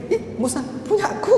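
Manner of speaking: put-on high, soft childlike whine; widow's high whine to Musa; whiny widow. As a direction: whine and tug for attention.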